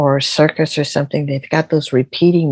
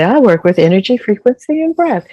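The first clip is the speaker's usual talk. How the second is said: rising higher with each item; rising list; 6 semitones higher, 3 dB louder than usual. Retold or imitated. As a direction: climb up on every word of the list. Quoting own website proudly.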